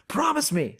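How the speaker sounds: high mom voice, pleading; lightly voiced mother, earnest; imitating mom. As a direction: mimic mom asking for a promise. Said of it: "One thing she ever asked me".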